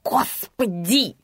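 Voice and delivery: slow, drawn-out exasperated hiss; scornful stretched hiss. A narrator's reading. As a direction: hiss it with long vowels. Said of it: "прошипела тётка".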